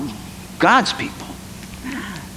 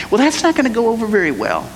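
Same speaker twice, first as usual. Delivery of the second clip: ordinary talk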